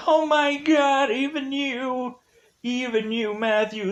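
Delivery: high, drawn-out dismay; mock horror. Nancy finding Matthew turned, mock horror. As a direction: wail it in hammed-up betrayal.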